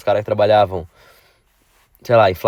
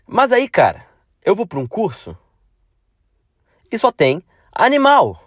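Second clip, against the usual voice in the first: exasperated; 8 semitones higher than usual. Real, not acted. Exasperated about coursemates.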